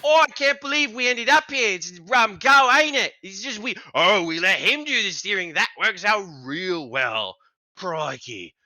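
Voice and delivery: loud, high rant in a put-on accent; big dude griping at full voice; high, hammed-up accented complaint; yelling in accent. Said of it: "are currently yelling at what appears to be a bush".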